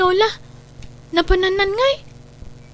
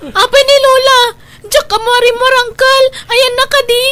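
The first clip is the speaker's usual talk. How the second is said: alarmed cry; 9 semitones higher, 5 dB louder than usual. Acted. News grandma is in danger.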